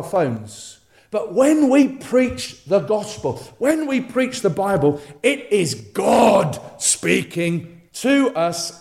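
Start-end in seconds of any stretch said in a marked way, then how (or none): 5.2-8.8 higher, very slow, shouted proclamation; preaching emphasis. Real, not acted. Preacher to congregation, slow emphatic shout. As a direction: proclaim it slowly with full force.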